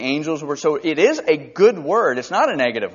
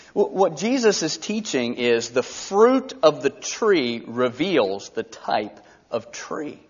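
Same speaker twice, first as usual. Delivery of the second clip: normal talking voice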